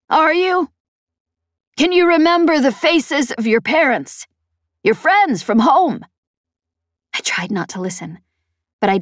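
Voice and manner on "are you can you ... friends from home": high-pitched, pressing questions; Peg challenging. Acted. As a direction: push each question at the pilot as Peg. Peg's character voice in narration.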